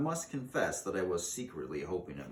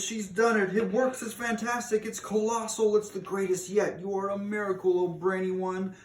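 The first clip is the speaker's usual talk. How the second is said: much higher, gushing praise; excited cheering; 11 semitones higher, 4 dB louder than usual. A narrator's reading. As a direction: witch crowd praising the Grand High Witch, much higher. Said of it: "yelled the audience".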